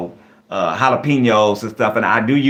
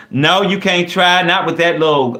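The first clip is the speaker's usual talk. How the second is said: higher-pitched, emphatic refusal; indignant refusal; 7 semitones higher, 3 dB louder than usual. Acted. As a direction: shut the request down firmly.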